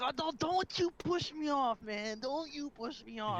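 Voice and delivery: high, slow, loud mock protest; imitating angry caller. Imitating the caller who refused to be brushed off, high mock whine. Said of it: "He's like".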